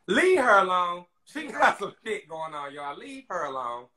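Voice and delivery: emphatic call to back off; protective plea. Retold or imitated. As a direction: stand up for her, loud and high. Defending her against the blogs.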